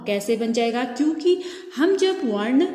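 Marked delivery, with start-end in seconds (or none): none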